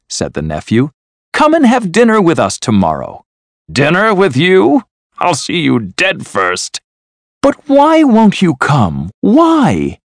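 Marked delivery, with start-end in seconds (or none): 1.3-3.2 high, friendly invitation; inviting nephew. A narrator's reading. Nephew asking uncle to dinner, friendly and high. 3.7-6.8 scornful refusal. A narrator's reading. Scrooge rejecting his nephew's dinner invitation, scornful. 7.4-10.0 high, insistent plea; pleading nephew. A narrator's reading. Nephew begging his uncle to come, pleading and high.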